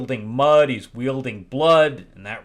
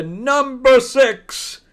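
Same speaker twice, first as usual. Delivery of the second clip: slow, loud, higher countdown announce; announcer call; 12 semitones higher, 7 dB louder, much slower than usual. Real, not acted. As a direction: call out the next entry like a show host. Countdown host announcing next entry.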